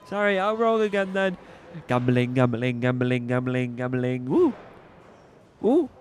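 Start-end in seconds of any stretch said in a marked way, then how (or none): 1.9-4.3 low caller voice. Acted. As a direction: lower chanting bingo call. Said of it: "you changed the voice again".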